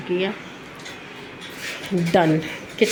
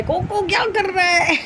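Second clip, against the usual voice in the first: high-pitched scolding cry; imitated sudden yell; 10 semitones higher than usual. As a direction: mimic someone crying out at the dog in sudden alarm. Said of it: "तो एकदम से चिल्लाए". (चिल्लाने की नकल, ऊँची आवाज़ में)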